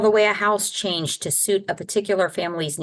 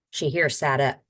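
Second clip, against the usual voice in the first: ordinary delivery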